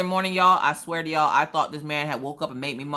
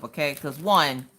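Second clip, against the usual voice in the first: normal talking voice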